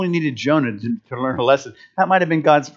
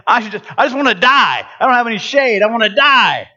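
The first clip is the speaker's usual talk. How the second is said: high, whiny wish to die; sulky Jonah; 6 semitones higher than usual. Retold or imitated. Jonah sulking over lost shade, high and whiny. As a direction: moan it like a spoiled prophet. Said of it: "And he says".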